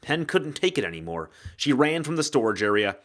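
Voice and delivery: plain reading voice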